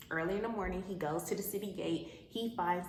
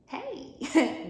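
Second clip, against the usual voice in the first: high, louder sing-song greeting; fake cheerful hey; 7 semitones higher, 6 dB louder than usual. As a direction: sweeten it suspiciously. Naomi greeting Ruth falsely sweet.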